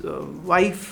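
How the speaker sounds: normal talking voice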